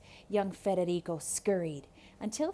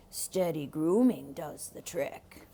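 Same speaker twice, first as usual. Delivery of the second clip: low sly wolf; 4 semitones lower than usual. Acted. Wolf replies.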